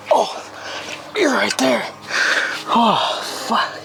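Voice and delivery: high strained exclamations; pushing through effort; straining. Real, not acted. Straining, almost there.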